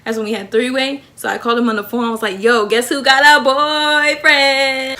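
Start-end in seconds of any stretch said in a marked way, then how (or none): 2.4-5.0 drawn-out singsong brag, climbing high; gleeful announcement. Acted. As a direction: stretch every word out, rising into a high wail. Announcing a new boyfriend to friends.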